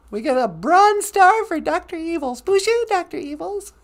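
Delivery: very high cheery announcement; squeaky puppet voice. Pretend. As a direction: chirp it like a tiny toy. High voice awarding bronze star.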